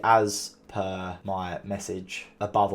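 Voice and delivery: passive aggressive. Shown as a demonstration. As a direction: drag it out with quiet passive-aggressive snark. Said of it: "This is just super passive aggressive".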